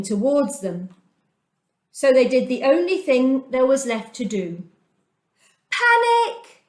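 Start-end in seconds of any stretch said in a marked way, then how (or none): 5.7-6.6 much higher, louder frightened shriek; panicked shriek. A narrator's reading. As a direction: cry out in terror as the army closes in. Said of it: "they shrieked".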